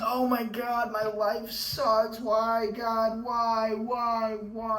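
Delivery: high, loud whiny lament to God; mock self-pity wail. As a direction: ham up a whiny cry of despair. Mocking self-pity after problems.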